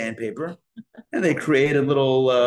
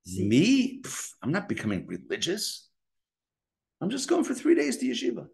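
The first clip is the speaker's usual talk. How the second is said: higher, light, casually coaxing self-talk; 7 semitones higher than usual. Retold or imitated. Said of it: "Tell yourself".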